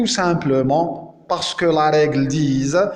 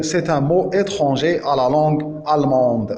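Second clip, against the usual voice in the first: ordinary delivery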